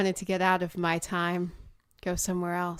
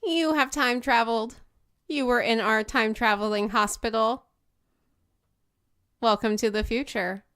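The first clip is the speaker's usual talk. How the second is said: robot voice; 4 semitones higher, 7 dB louder than usual. Acted. Robot character speaking.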